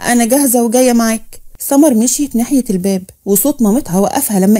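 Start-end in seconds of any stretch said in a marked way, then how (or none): none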